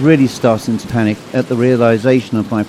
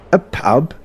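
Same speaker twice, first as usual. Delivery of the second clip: higher, questioning echo; inquisitive; 4 semitones higher than usual. Acted. Constable questioning the patient's memory, inquisitive. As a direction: query it as a puzzled constable. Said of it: "looked at me inquisitively and said".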